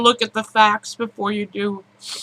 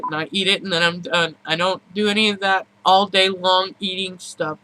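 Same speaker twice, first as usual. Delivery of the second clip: ordinary talk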